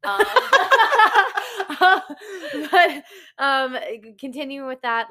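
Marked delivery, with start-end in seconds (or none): 0.2-2.7 high, loud giggle burst; burst of laughter. Real, not acted. After a poke mid-podcast, bursting into high laughter. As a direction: laugh out, caught off guard.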